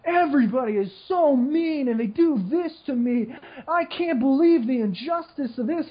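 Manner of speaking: high, self-pitying whine; mock whiny victim. Mocking whiny high school friends, high self-pity. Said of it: "whining and whining".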